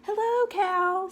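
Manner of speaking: high, loud, slow duck voice greeting the cow; cheery duck greeting. Acted. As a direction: call out hello high and slow like a happy duck.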